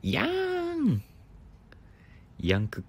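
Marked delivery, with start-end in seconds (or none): 0.0-1.0 high drawn-out whine. Pretend.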